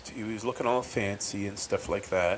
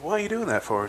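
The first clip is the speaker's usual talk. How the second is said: pitched up, disbelieving; retold exasperated question; higher incredulous question; 10 semitones higher, faster than usual. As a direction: ask it high, baffled. Retold quarrel with friend.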